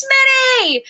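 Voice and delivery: high-pitched, drawn-out call of a name. Retold or imitated. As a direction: imitate a sergeant hailing a trainee from afar. Sergeant calling out to trainee.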